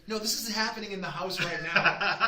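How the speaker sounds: telling his wife, laughing; laughing reply. Retold or imitated. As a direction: insist it through laughter. Own reply retold laughing.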